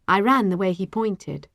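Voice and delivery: usual speaking voice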